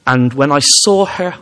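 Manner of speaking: ordinary delivery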